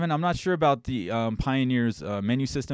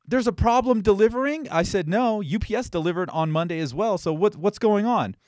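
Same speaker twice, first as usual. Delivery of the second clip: higher, louder, disbelieving challenge; retold indignant argument; 8 semitones higher, 3 dB louder than usual. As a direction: throw the excuse back at the courier.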